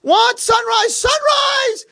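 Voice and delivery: extremely high, loud holler; shrieked morning enthusiasm; excited shouting. Acted. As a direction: shout it out like a wake-up alarm. Roommate keeps shouting the dawn news.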